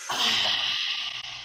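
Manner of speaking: a deep, low sigh of release; long exhale sigh. Shown as a demonstration. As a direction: let all the air out with a long sigh. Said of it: "breathe them out".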